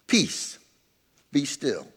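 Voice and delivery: calm, soft, low command to the storm. A narrator's reading. Jesus calmly stilling the storm, soft and calm. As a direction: speak peace quietly and steadily. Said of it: "he wakes up calmly and he speaks".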